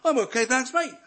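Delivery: loud, high, dismissive brush-off; mock a smug Brit turning you down; proud Brit. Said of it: "We're called to go to proud Brits".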